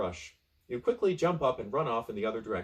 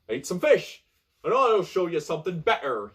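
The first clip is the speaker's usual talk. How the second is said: louder, higher hearty pirate; booming pirate; 5 semitones higher, 7 dB louder than usual. Acted. Pirate offers fish to the castaway, loud and hearty. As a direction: boom it out like a pirate host. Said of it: "he says".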